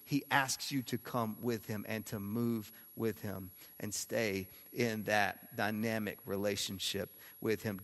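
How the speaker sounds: normal talking voice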